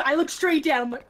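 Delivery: ordinary talk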